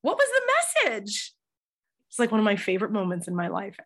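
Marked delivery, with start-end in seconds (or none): 0.0-1.3 asked much higher, genuinely puzzled; retold wonder at a secret; curious question. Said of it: "And she said".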